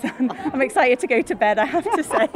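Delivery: laughing through the words. Real, not acted. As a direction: chuckle while you say it. Tired after the concert, laughing.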